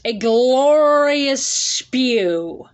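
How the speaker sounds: drawn out, savoring each word; slow grand proclamation. Acted. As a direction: announce it like a grand title. Dramatic title read.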